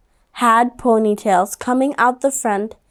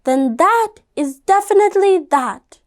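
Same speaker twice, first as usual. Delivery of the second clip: firm mom; 7 semitones higher than usual. Acted. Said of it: "said her mom".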